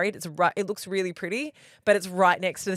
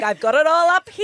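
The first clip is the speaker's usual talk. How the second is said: much higher and louder in a put-on voice, smugly confident; imitating Keisha; 10 semitones higher, 10 dB louder than usual. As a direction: ham up a cocky know-it-all friend. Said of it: "Keisha's like".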